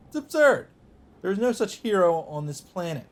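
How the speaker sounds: loud and high, can't believe it; incredulous outburst. Acted. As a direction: burst out in disbelief that this hero exists. Lancer's disbelief at impossible shield.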